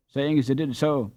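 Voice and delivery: plain reading voice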